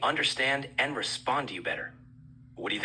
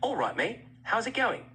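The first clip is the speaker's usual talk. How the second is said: casual British greeting, voice lifted; British accent; 7 semitones higher than usual. Shown as a demonstration. Voice assistant shows the user different English accents, British accent. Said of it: "Let's start with a British accent".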